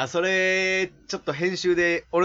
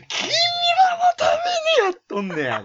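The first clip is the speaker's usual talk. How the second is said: noisy outburst. Acted. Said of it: "いや、うるさいね". (うるさいほど甲高い芝居の叫び)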